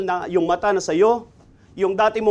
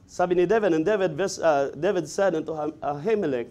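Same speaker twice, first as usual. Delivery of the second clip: usual speaking voice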